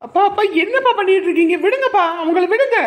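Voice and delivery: very high, loud cry to stop; frantic plea. Acted. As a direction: shout in alarm to pull father off. Begging father to stop attack. (பதறிக் கத்திக் கெஞ்சும் குரல்)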